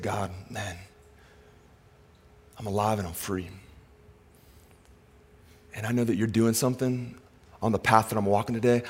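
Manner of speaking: soft, unhurried talk with God; hushed, sincere morning prayer; intimate prayer. Acted. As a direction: pray quietly, letting pauses breathe.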